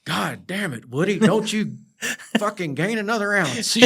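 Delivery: mock exasperated yell. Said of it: "our safety guy there was like".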